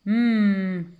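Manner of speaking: M as a long hummed "mmm"; letter sound. Shown as a demonstration. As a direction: hum the M steadily, lips closed. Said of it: "cette lettre-là chante". (M chanté, « mmm » prolongé)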